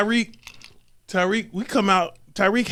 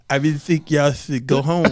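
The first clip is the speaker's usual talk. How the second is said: put-on Mister Turner accent; 5 semitones lower than usual. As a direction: lightly mimic the teacher telling boys to go home. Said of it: "The acc-, the accent is on point".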